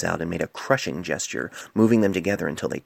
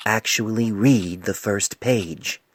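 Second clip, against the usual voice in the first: slow insistence; 3 semitones higher, much slower than usual. Acted. Mayor insisting.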